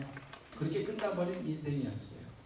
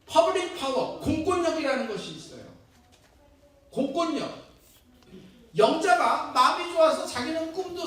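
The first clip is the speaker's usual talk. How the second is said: louder rousing sermon voice; preaching; 7 semitones higher, 10 dB louder than usual. Real, not acted. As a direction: challenge the congregation from the pulpit. Pastor preaches at full force. (힘차게 외치는 설교 톤)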